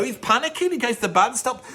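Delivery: ordinary talk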